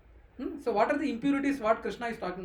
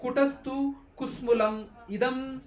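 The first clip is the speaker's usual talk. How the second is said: slow verse recitation; slower than usual. Shown as a demonstration. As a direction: chant each word slowly with long pauses.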